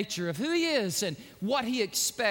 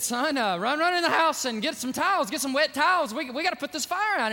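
raised, louder, hurried father's orders; acted mild alarm, pitch up; nervous urgency; 6 semitones higher, 5 dB louder than usual. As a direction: send the boy running, acting rattled. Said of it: "started getting a little bit nervous".

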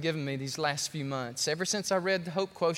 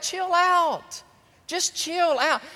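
chill out; 12 semitones higher, 5 dB louder, much slower than usual. Acted. God telling him to calm down.

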